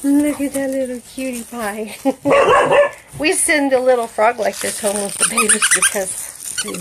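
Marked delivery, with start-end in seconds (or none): 2.0-3.1 laugh. Real, not acted. Burst of laughter.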